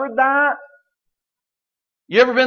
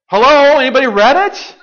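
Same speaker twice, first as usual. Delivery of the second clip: loud, higher call to the room; 6 semitones higher, 7 dB louder than usual. Real, not acted. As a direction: rouse the crowd with a loud call. Calling out to the congregation.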